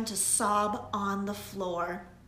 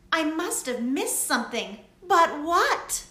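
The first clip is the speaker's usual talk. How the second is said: put-on higher girlish voice, louder, rising to a worried question; young witch Lily, worried; 7 semitones higher, 8 dB louder than usual. A narrator's reading. Lily puzzling over her failed potion, worried. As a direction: voice the little witch fretting over her spell.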